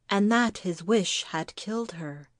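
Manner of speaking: ordinary delivery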